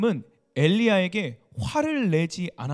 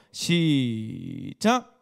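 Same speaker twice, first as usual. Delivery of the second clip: slow, lower reading cue; drawn-out cue; 7 semitones lower, much slower than usual. Real, not acted. Preacher cues congregation to start reading, drawn-out cue. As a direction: stretch the first syllable long, then release. Cueing congregation to read together.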